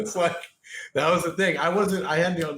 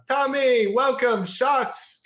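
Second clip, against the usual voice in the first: high, loud mock welcome; boisterous greeting; 10 semitones higher, 4 dB louder, much slower than usual. In-laws greeting him at the door, boisterous high shout. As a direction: call it out like rowdy in-laws.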